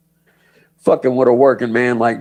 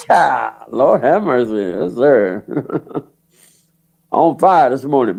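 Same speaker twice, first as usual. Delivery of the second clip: usual speaking voice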